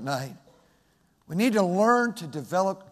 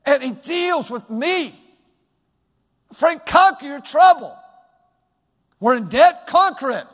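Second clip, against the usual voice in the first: loud, rising pulpit preaching; preaching at full force; 6 semitones higher, 7 dB louder than usual. Acted. Pastor exhorting the congregation, loud preaching. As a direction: preach it out with fire to the church.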